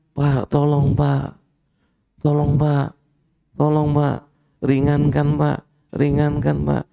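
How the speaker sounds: high, pleading voice of a man begging the judge; tearful plea for mercy. Acted. A convicted man begging the judge for leniency, mock-tearful pleading. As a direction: beg the judge to lighten the sentence, half-crying. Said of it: "Nangis dia".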